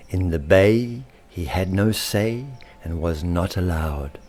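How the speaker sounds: usual speaking voice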